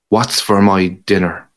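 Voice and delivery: ordinary delivery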